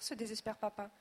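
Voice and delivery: usual speaking voice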